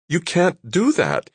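high and panicky. A narrator's reading. Nick panicking that Claire is staying, high and panicky. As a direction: let the panic climb into the voice. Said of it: "his voice high, panicky".

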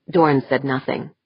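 ordinary delivery, nothing marked

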